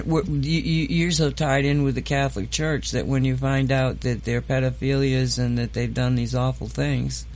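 ordinary delivery

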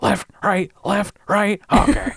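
rising, puzzled monster mutter; confused werewolf voice. Retold or imitated. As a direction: glance left and right, growing baffled. Werewolf dithering, acted out.

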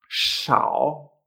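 SHAO with a hushing sh and dipping tone; third tone. Shown as a demonstration. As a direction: dip then lift on SHAO. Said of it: "en tercer tono se pronuncia shao".

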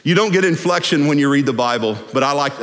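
usual speaking voice

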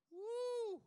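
high, loud celebratory whoop; joyful woo. Real, not acted. Preacher celebrating to the congregation, loud whoop. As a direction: whoop with delight.

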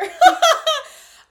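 a burst of high, loud laughter; shrieking laugh. Real, not acted. Podcaster laughs at her grim story title, loud shrieking laugh. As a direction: cackle at your own dark joke. Laughs at own dark intro.

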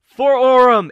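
loud high battle cry; rallying cry. Acted. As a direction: raise it to the crowd. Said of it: "He's like".

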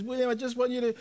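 a touch of mum breaking down, begging; mild imitated crying mum. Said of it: "my mum's kind of breaking down and she's crying".

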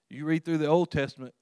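usual speaking voice

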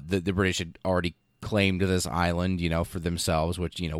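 usual speaking voice

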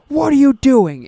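sharp high loud scolding teacher; music teacher scolding. Retold or imitated. Teacher catching a kid with popcorn in his nose, sharp scolding. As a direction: snap it out like a caught-you teacher. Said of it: "And she goes".

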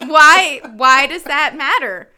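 loud, exasperated protest; indignant rant. Real, not acted. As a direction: demand a reason, raising your voice. Indignant rant demanding an answer.

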